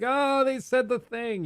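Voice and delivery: high, slow delighted gasp at a callback; excited fan gasp. Retold or imitated. Fan spotting a prequel callback, mock delighted gasp. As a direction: play the thrilled fan who caught the reference.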